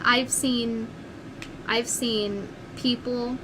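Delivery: usual speaking voice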